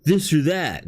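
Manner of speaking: mock finger-pointing rant; accusatory. Imitating an angry commenter attacking anyone who disagrees, accusatory. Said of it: "and be accusatory".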